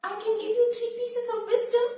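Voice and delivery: high quick bird voice; eager little bird. Acted. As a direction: chirp the offer quickly. Bird offers wisdom.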